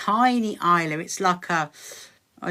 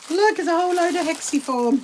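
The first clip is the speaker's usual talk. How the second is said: pitched up with real delight; excited; 8 semitones higher, 3 dB louder than usual. Real, not acted. Showing viewers a big bunch of fabric, excited. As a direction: show it off like a treasure. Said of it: "very exciting".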